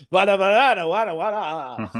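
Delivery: mock thick Cajun mumble, half laughing; Cajun gibberish. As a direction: imitate Channing Tatum's Cajun gibberish, loosely. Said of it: "speaking gibberish in that accent".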